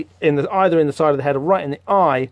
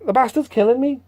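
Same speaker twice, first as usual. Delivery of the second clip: panicked high yelp; 8 semitones higher than usual. Acted. Jack panicking in comic.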